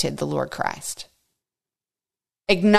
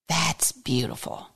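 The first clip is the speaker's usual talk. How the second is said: hushed whispered awe; 5 semitones lower, 8 dB quieter than usual. Real, not acted. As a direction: breathe it out in a whisper. Whispered aside in sermon.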